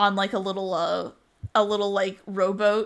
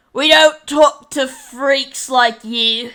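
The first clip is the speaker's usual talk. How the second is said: loud, slow, higher jeer; sneering guard; 4 semitones higher, 9 dB louder, slower than usual. Acted. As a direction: drag out the insult at the caged freak. Guard sneers at caged prisoner.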